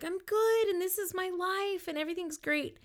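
high, happy retold catching-up; acted-out upbeat reunion reply; cheerful greeting. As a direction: gush good news while hugging everyone. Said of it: "hugging people and just like".

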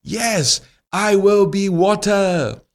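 high, slow, resolute vow; determined wish. Acted. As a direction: declare it slowly, up high. Fire resolves to be water.

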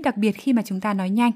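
usual speaking voice

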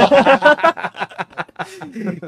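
loud real laughter; can't hold back a laugh; laughing burst. Real, not acted.

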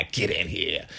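big laugh into a hammed-up New York accent; bad New York accent. Bus driver waves the passenger aboard, bad New York accent. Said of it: "That was my bad New York accent".

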